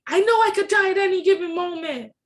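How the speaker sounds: high, loud, fast outburst; reliving a sudden angry outburst at her sister; snapping in anger. Retold or imitated. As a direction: snap it out, losing control. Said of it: "I just snapped".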